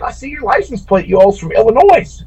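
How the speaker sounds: high-pitched southern drawl, "Illinois" ending in a z sound; southern gate lady saying "Illinois" as "Illinoise". Retold or imitated. Gate lady spotting the license plate, southern drawl. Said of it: "in her southern drawl".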